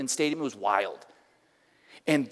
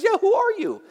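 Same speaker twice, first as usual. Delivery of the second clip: very high, loud chiming in; amazed outburst; 7 dB louder than usual. Retold or imitated. Girlfriend joining in to ask the stranger, amazed. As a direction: squeal it in mock astonishment.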